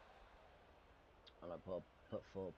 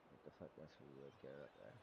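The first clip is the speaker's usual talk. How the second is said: quiet, annoyed swearing under breath; frustrated mutter; 5 semitones lower, 14 dB quieter than usual. Real, not acted. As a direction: grumble it to yourself. Annoyed at own shot choice.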